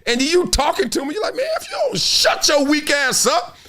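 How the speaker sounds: fired-up shout at weak people; heated rant. Real, not acted.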